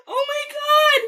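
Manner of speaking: high, tearful wail of joy; crying in disbelief; real happy crying. Real, not acted. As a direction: wail it, overwhelmed by the gift. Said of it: "I can't believe I'm crying".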